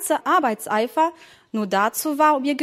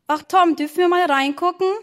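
pleading; 6 semitones higher, 4 dB louder than usual. Acted. Children plead with Tom.

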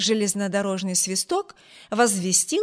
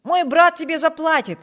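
higher and louder careless call; shouted; 7 semitones higher, 7 dB louder than usual. A narrator's reading. Wibert shouting to the groom as he grabs the reins, loud call. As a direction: fling it over your shoulder as you drive off. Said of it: "он крикнул молодому человеку".

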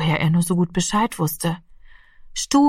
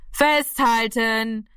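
high, long-drawn call; 4 semitones higher, much slower than usual. A narrator's reading. Accomplice to the boy at the window, calling out. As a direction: stretch the warning out as a raised call. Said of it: "rief Gaeto".

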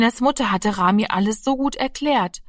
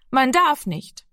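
kindliches Nein; 6 semitones higher, 3 dB louder, slower than usual. A narrator's reading. Junge wehrt sich leise trotzig.

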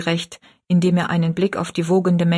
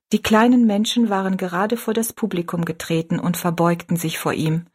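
plain reading voice

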